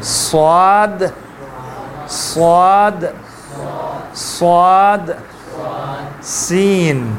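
صاد drawn out with a whistling hiss; whistling sounds. Shown as a demonstration. Teacher showing the whistle in صاد and سین, drawn out. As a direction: stretch صاد long and hiss it. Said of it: "سیٹی کی سے آواز آنا چاہیے".

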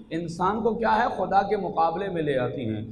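usual speaking voice

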